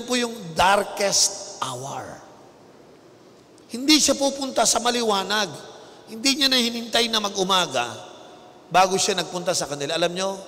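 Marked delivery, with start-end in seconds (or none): none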